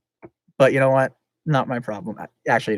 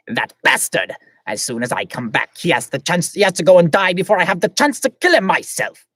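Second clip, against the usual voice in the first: Flara voice; 5 semitones higher, 5 dB louder than usual. Acted. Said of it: "can I do the Flara voice anymore?".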